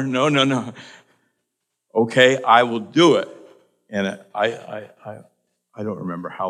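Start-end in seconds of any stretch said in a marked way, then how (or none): 0.0-0.7 loud, higher no no no; mock refusal. Refusing God's prompt. 1.9-3.3 loud, resolved giving in; loud surrender. Retold or imitated. Giving in to God.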